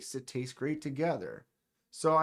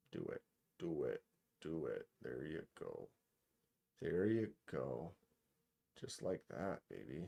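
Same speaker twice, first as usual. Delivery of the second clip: much softer, urging the camera on under his breath; murmured encouragement to a stubborn lens; hushed coaxing; 13 semitones lower, 14 dB quieter than usual. Real, not acted. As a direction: coax it gently like a nervous animal.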